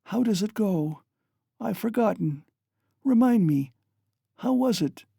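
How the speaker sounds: high, rushing delirious chatter; delirious mother recalling a dance and song; feverish excitement. A narrator's reading. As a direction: rave happily in fever. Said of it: "She was violently excited".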